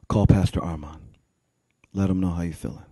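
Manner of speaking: low, soft, measured counsel; deep quiet voice, pausing between instructions; calmly direct, hushed and low; God, gently instructing. Acted. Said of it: "he just whispers something so gentle".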